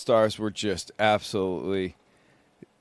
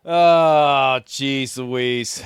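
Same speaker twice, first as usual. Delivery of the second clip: ordinary talk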